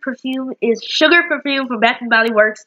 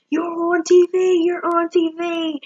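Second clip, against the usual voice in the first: excited relatives; 9 semitones higher than usual. Retold or imitated. Family calling about her movie.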